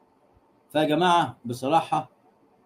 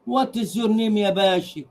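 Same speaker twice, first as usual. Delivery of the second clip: ordinary delivery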